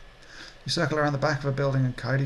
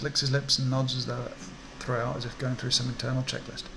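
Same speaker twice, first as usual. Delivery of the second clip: ordinary talk, nothing marked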